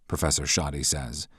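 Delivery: ordinary talk, nothing marked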